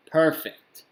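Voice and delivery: loud, high, happy exclamation; excited. Real, not acted. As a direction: burst out with delight. Streamer reacts to audio feedback.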